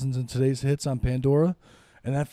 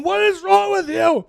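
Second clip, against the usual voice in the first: wife's alarm; 13 semitones higher, 4 dB louder than usual. Retold or imitated. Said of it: "she's going".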